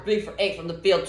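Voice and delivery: usual speaking voice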